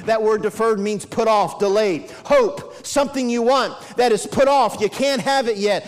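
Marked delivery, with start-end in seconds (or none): none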